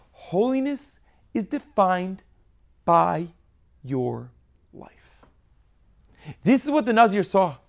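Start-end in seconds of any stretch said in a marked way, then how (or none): 0.1-5.2 slow, softer and softer until "life." is whispered; slow build down, "life." only breathed; fading to a whisper. Shown as a demonstration. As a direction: let "Holiness is defined by your life" sink to a hush. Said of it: "Drill that in your head".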